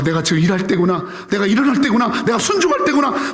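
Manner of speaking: usual speaking voice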